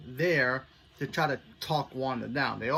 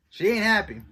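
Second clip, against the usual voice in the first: louder and higher, punched out; emphatic; 8 semitones higher, 8 dB louder than usual. Real, not acted. Viewer stressing Wanda's anger, emphatic and loud. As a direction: hit it hard for effect. Wanda angry at Sword director.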